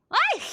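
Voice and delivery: high loud startled shriek; piercing shriek. Acted. Martha startled by the player, piercing shriek. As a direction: shriek in fright. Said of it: "gives that piercing shriek".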